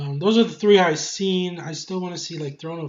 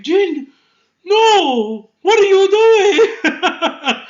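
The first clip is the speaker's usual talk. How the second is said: high shocked cry, laughing through it; a shocked uncle yelling Jin, no with a laugh; uncle's alarm; 13 semitones higher, 9 dB louder, much slower than usual. Retold or imitated.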